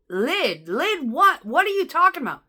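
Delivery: loud, high disbelief; can't believe what he's hearing; incredulous. Retold or imitated. Driver baffled by the coworker's complaint, loud and incredulous.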